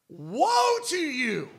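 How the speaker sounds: thundering prophetic woe; booming woe. Acted. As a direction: call out the warning like a prophet. Preacher proclaiming scripture warning.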